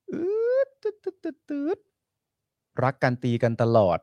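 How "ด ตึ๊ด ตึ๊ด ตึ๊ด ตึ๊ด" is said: high mock beeping noise; imitated beeps. Host beeping out words with high mock beeps. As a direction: beep like a censor tone. Host mimics a beep sound.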